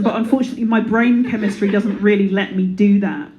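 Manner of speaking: ordinary talk, nothing marked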